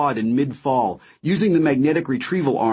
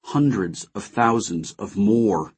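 usual speaking voice

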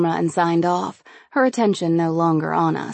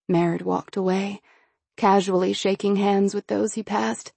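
ordinary delivery

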